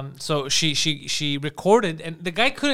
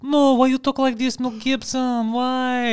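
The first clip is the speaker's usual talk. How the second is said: mock wife; 6 semitones higher, slower than usual. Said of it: "then she's like".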